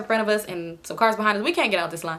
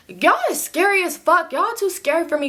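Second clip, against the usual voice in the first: mocking scorn; 7 semitones higher than usual. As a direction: tease them for being scared. Amber mocks scared friends.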